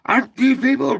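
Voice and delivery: high, loud exasperated shout; exasperated yell for help; frustrated outcry. Shown as a demonstration. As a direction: blurt it out fed up. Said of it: "try starting it off with".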